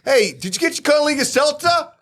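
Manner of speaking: high, bright ad pitch; commercial voice. Pretend. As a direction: sell it like a cheesy ad. Mock seltzer ad quote.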